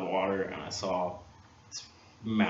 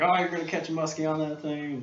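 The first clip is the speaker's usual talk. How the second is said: mock doubting stranger; 7 semitones higher than usual. Mocking stranger quoted.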